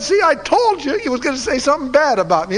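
high, loud mock complaint; indignant I-told-you-so. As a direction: whine it loudly like a vindicated tattletale. Acted complaint of king to ally.

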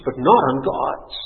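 ordinary talk

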